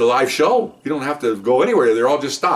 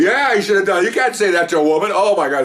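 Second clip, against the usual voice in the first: raised, high-pitched imitation of women; outraged women; 7 semitones higher, 4 dB louder than usual. Women witnesses back the officer, indignant exclaiming.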